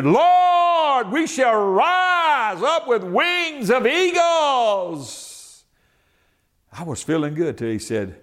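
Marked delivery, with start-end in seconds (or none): 0.0-5.6 high, slow soaring shout; shouted prayer. Retold or imitated. Preacher retelling his claim to God, high, slow and shouted. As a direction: proclaim the verse to the heavens.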